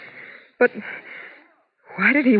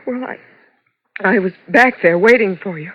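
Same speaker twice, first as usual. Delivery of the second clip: ordinary talk, nothing marked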